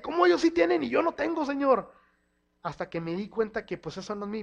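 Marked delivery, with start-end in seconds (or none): 0.0-1.9 high, loud, aggrieved plea; raised, resentful cry of why them and not me; envious complaint. Retold or imitated. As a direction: whine at God about the unfairness.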